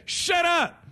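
hammed-up snap at the spouse; mock snap. Snapping at the spouse.

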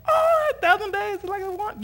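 high, loud excited outburst; amazed realization. Retold or imitated. Preacher realizing the camper's story is scripture, high excited cry. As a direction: blurt it in giddy amazement.

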